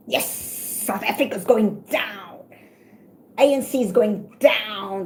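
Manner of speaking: slow, mocking gloat at the country's collapse; lightly voiced smug doomsayer, drawn out. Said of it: "Why are you talking like that?".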